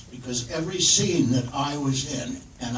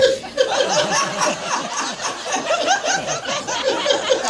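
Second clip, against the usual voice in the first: a very high, long squeal of laughter; shrieking laugh. Real, not acted. Laughing while trying to recall the book title. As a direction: squeal with delight.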